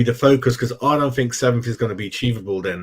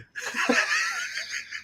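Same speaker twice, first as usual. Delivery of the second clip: high laugh; 10 dB quieter than usual. Real, not acted. Laughs at own joke.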